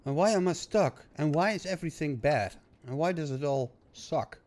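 exasperated whining. Real, not acted. Gamer whining at the stuck factory, exasperated then fading. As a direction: vent at the game, then run out of steam. Game keeps going wrong, venting.

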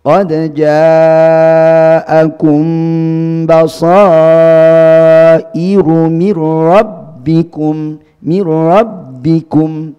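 very slow, loud, high, drawn-out recitation of mir rabbikum; tahqiq practice. Shown as a demonstration. Teacher demonstrating tahqiq recitation to students, loud and slow. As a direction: project it fully, stretching every sound. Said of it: "ketika tahqiq ini dipraktekkan".

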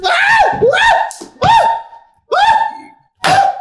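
repeated high screams of fright. Real, not acted. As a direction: shriek at a bug. Cockroach appears suddenly.

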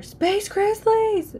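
very high, drawn-out frightened wail; long scared wail. A narrator's reading. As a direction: wail it out in terror. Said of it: "she wailed".

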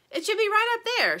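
high, loud little-bird voice; excited little bird. A narrator's reading. Little bird insisting the house is there, high and excited. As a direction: pipe up as an eager little bird.

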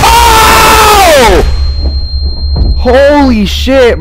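screaming out at a jump scare; terrified shout. Real, not acted. Streamer jolted by a jump scare, shrieking.

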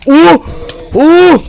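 two high, loud yelps; short high-pitched startled cries. Real, not acted. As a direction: yelp "oo" in shock. Sudden attack by horses.

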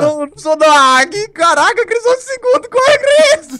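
very high frantic panicked yelling; scared horror game streamer. Real, not acted. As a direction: scream in panic for him to run. Frantic countdown during chase. (gritando apavorado)

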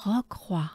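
Recroix with a breathy French r. Shown as a demonstration. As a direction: say Recroix as rekwa, r only a breath. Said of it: "Good breath with me".

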